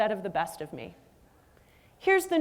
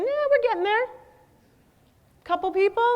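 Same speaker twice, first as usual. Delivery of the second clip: encouraging; 9 semitones higher, 5 dB louder than usual. Real, not acted. Encouraging audience hands.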